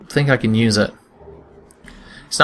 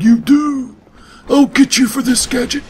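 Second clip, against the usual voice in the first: higher, far-off bad villain voice; awful Doctor Claw; 9 semitones higher than usual. NPC vows revenge on the player, bad Doctor Claw impression. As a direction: do a terrible cartoon villain threat. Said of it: "doing a Doctor Claw impression? Because it's awful".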